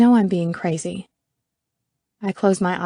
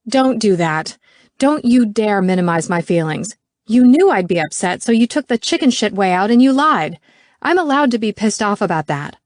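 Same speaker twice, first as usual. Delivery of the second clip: louder, heated scolding; angry; 3 semitones higher, 6 dB louder than usual. Acted. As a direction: refuse to be dismissed, push back hard. Angry confrontation.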